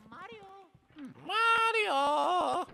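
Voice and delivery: high, far-carrying call for a lost brother; calling Mario. Pretend. Luigi calls out for Mario, high and carrying. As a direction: call out for your brother across the mansion.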